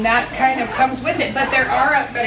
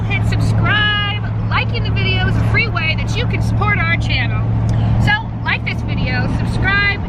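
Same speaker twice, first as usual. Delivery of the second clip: upbeat, higher outro voice; vlog outro; 7 semitones higher, 3 dB louder than usual. Acted. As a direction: sign off brightly to viewers.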